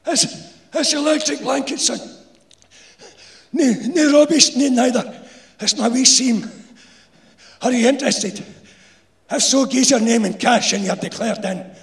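high, loud Glaswegian salesman; old insulator voice. A narrator's reading. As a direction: sell it like a shifty old worker. Insulator pitching his deal.

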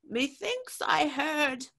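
hesitant, half-sure; a drunk watchman unsure what he heard; uncertain watchman. A narrator's reading. Doubtful invader.

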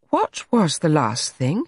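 slow, intent question with a swooping rise; drawn-out, mildly eager curiosity. A narrator's reading. John asks Allegra about the last piece, mildly eager. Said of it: "John asked eagerly".

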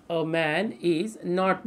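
slow, drawn-out words; dictating. Real, not acted. Teacher writes out the negation for students, dictating slowly. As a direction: spell out the sentence word by word.

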